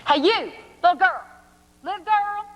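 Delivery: high, loud calling out; yelling. Acted. Saleslady yells after girl running off with hat. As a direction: shout after the fleeing girl. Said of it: "She runs down the steps yelling".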